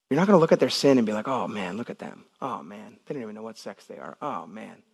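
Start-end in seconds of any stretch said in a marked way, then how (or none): 1.3-4.8 mock judgmental murmur. Mocking judgmental churchgoer.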